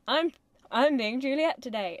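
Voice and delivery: grand mock announcement; self-important actor. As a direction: proclaim it like a pretentious young actor. Mocking a distant actorly attitude.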